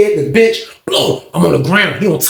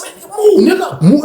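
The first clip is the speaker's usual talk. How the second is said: much higher, heated demand; angry yell; 12 semitones higher than usual. Retold or imitated. Pushing the guy off the bag: heated high yell. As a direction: shove the words at him to move. Cell fight, demanding he move.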